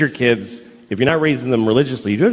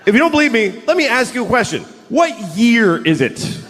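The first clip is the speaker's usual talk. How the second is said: escalating comedy rant; 9 semitones higher than usual. Real, not acted. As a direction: build the rant to a peak.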